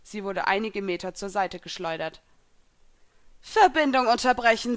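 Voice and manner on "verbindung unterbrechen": raised, loud order; urgent command to a servant. A narrator's reading. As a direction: order it stopped at once. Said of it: "befahl meine Gegnerin".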